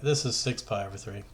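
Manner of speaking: usual speaking voice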